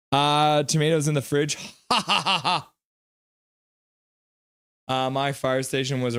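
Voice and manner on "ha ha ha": high, drawn-out ha-ha laugh; staccato laugh in a run. Real, not acted. Laughing at a chat joke.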